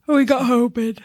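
talking through a yawn; words stretched by a yawn. Real, not acted. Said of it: "I was yawning".

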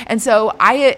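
normal talking voice

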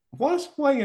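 high, startled "What?"; exaggerated disbelief; mock shock. Real, not acted.

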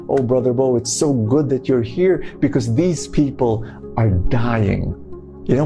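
usual speaking voice

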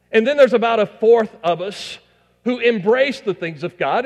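high, loud pulpit preaching; full-force preaching. Acted. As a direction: preach it out to the whole congregation.

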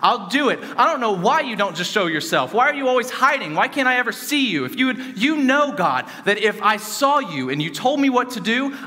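frustrated yelling. Retold or imitated. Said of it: "I just started yelling at him because I was frustrated".

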